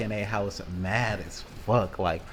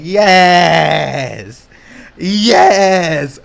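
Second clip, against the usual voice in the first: much louder, higher triumphant yes; gleeful yell; 10 semitones higher, 17 dB louder than usual. Retold or imitated. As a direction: shout like you just scored. Gleeful at target for insult.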